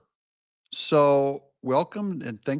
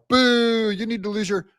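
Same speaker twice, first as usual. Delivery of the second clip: mock booing; 8 semitones higher than usual. Crowd turns on Democrats after the election, mock booing. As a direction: jeer like a turned crowd. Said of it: "everyone's going like".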